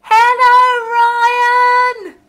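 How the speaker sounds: a high, loud, very drawn-out hello; long called greeting. Real, not acted. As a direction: call it out across the world to Australia. Calling out to Australia.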